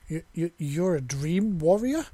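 high, loud stammered disbelief; stunned stammering question; shocked exclamation. A narrator's reading. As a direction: blurt it out in shock, tripping over the words. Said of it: "she exclaimed".

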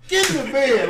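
mock mom barking an order; mama scolding. Mimicking his mom ordering him to bed at night, high and loud. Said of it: "my mama woke up, came in there".

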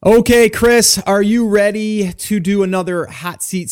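excited. Real, not acted. Host kicks off the session.

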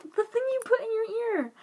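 high, eager blurt; excited exclamation. Retold or imitated. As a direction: shout the answer out. Retold excited quote.

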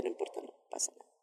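fast whisper, brushing it off; hushed and quick, shrugging it away; whispered to herself. Real, not acted. Se lo dice a sí misma. (susurro rápido para sí misma, restándole importancia)

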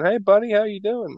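friendly American passerby. Retold or imitated. Americans on a bus greeting a stranger, friendly. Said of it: "you'll get people just going".

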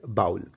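low बाउल with "ow", like owl; common mispronunciation. Shown as a demonstration. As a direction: drop low on बाउल, "baa-ul". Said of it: "क्या बोलते हैं उसको लोग?".

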